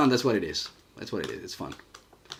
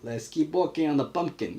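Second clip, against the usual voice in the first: high, louder cartoon New York-Italian-Mexican accent; goofy, higher-pitched hybrid accent; made-up mixed accent; 7 semitones higher, 3 dB louder than usual. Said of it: "I like that kind of accents".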